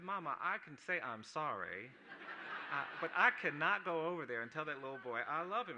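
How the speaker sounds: loud laughing plea to grandma; boy's laughing protest. Retold or imitated. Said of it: "I said".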